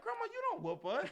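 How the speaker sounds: very high-pitched mock child's protest; retold kid protest. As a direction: pipe up high like a grandkid to grandma. Kids protest to whooping grandmother.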